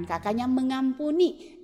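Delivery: ordinary talk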